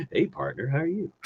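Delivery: laughing Texas greeting; Texas accent. Acted. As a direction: say howdy in a soft Texas twang. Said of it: "my Texas accent from now on".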